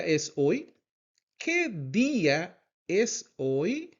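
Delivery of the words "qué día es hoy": "¿Qué día es hoy?" slowly, word by word; slow teacher's "¿Qué día es hoy?", rising at "hoy"; slow model repeat. Shown as a demonstration. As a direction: stretch out "¿Qué día es hoy?" with gaps for learners.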